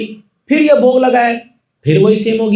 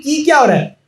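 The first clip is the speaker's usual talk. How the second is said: higher, fast astonished cry; stunned amazement; 7 semitones higher, faster than usual. Acted. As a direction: gasp it out in wonder. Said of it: "ये तो पूरा अवाक रह गया". (अवाक होकर तेज़ ऊँचे स्वर में)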